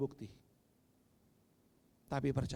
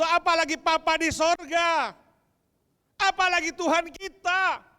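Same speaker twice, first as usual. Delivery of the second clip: high, loud fiery preaching; preaching at full force; 11 semitones higher, 10 dB louder than usual. Acted. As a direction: proclaim it to the congregation with full passion. (khotbah berapi-api dengan suara lantang)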